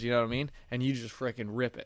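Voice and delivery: ordinary talk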